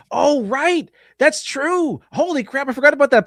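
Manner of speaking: sudden high realisation; surprised. Real, not acted. Remembering the plot, suddenly high and surprised.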